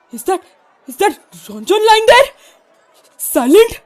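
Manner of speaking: shocked disbelief. Acted. Rebel seeing Ranjan lying dead, shocked and rising.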